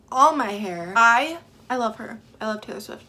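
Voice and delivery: blurted loud devotion; loud declaration. Real, not acted. Fan shouting love for her idol.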